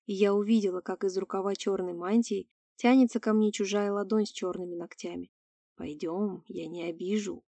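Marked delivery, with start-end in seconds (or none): 5.8-7.4 low soft wheezing lure; gurgling alien voice. Acted. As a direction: coax her in a low quiet rasp. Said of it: "прохрипело существо".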